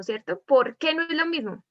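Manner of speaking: usual speaking voice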